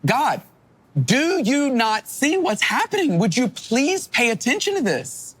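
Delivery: high-pitched exasperated cry to God, voice jumping far up; pleading yell at God. Retold or imitated. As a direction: demand God's attention, high and urgent. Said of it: "It's as if David is yelling at God".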